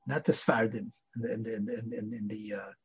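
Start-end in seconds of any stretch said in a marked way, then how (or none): none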